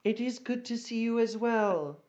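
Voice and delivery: high, regal greeting; lofty royal welcome; gracious empress. Acted. Empress greets her goblin friend.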